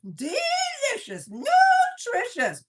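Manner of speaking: slow, high, loud sing-song praise; excited shout. Real, not acted. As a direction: belt out the praise, drawn out.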